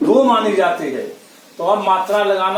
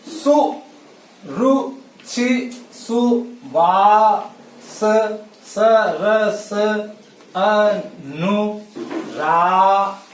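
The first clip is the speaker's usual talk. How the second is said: syllable by syllable; much slower than usual. Shown as a demonstration. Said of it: "इन्हीं".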